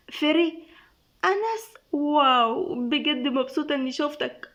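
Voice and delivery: high, affected flirty greeting; coquettish. A narrator's reading. Ferry delighted to see Anas, coquettish. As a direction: gush with coy delight. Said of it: "الصوت المسهوك".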